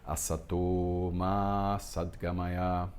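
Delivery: Asato said slowly with a clear S, not Z; slow, even syllables with the long vowels held; Sanskrit pronunciation. Shown as a demonstration. Teacher demonstrates the S in the mantra slowly. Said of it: "nunca som de Z".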